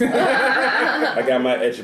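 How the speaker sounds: high, slow laughing mock voice. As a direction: ham it up, high and drawn out. Mocking his own claim.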